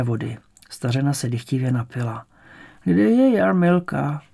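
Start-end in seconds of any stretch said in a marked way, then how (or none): 2.8-4.2 put-on high, slow old woman; old woman's voice. A narrator's reading. Bedridden grandmother asking for her granddaughter, high slow voice. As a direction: ask slowly as a frail grandmother.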